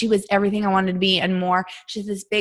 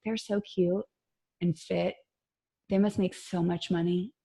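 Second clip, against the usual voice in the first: much softer, as if thinking aloud; quiet private musing; hushed inner thought; 9 dB quieter than usual. Retold or imitated. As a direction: murmur it to yourself while admiring someone. Private envious thought while watching instructors.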